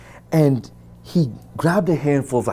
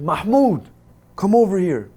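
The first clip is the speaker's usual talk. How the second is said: calling out; 7 semitones higher, 6 dB louder than usual. Retold or imitated. Guard calls a colleague.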